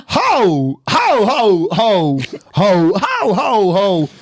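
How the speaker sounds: high exclamatory recital of pop lyrics. Acted. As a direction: punch out each cry high, then halting lyrics. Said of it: "They made him read, um, Poker Face".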